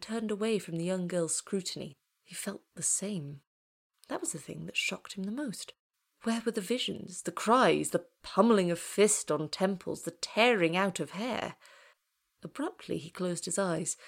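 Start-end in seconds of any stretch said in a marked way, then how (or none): none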